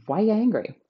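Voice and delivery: high, loud why angry; exasperated outburst. Real, not acted. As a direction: burst out in disbelief. Exasperated at rude customer.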